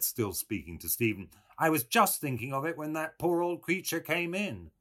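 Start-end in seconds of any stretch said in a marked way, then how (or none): 1.6-4.7 high, polite Englishman; earnest Englishman. A narrator's reading. Englishman explains himself to Stephen, high and earnest. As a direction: raise the pitch, mildly eager gentleman.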